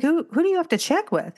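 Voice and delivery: high, baffled question; incredulous. Retold or imitated. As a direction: demand who he means, high. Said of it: "I'm like".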